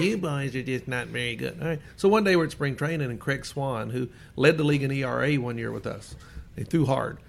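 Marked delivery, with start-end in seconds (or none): 0.0-1.6 a hint of a sneering old writer; lightly put-on voice of a critic talking down; mild mock voice of a snide reporter; mocking writer's voice. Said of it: "he kinda talked like this".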